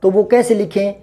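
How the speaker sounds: ordinary delivery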